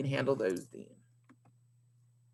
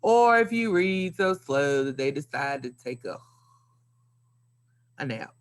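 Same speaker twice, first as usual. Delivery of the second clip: slow reading. Shown as a demonstration. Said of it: "You were just reading too slow".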